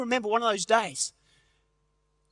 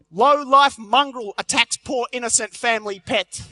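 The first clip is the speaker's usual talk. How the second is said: slow, punchy headline announcement; headline read; 5 dB louder than usual. Retold or imitated. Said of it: "half page headline reads".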